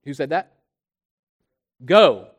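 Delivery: normal talking voice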